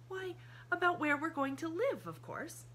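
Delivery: put-on high child's voice, innocently surprised; a girl amazed he doesn't see; surprised little girl. A narrator's reading. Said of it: "in obvious surprise".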